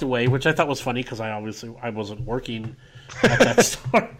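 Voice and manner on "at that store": laughing through the words; breaking into a laugh mid-line. Real, not acted. Laughing at own joke.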